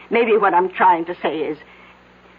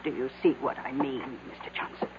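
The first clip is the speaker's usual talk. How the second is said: barely voiced, breathy whisper to the boss; hushed aside; 5 semitones lower, 12 dB quieter than usual. Secretary whispering a warning to her boss, hushed. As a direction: lean in and whisper it so the visitor can't hear.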